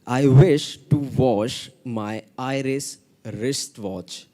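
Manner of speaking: slow, deliberate "I wish to wash my Irish wristwatch"; slow careful repeat. Shown as a demonstration. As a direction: say "Irish wristwatch" slowly and clearly. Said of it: "Okay, I'm repeating again".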